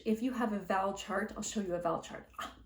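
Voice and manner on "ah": normal talking voice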